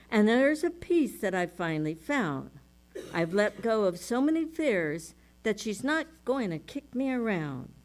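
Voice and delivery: rhythmic, emphatic lyric recital; poem reading. Acted. Minister reading song lyrics to the congregation, rhythmic.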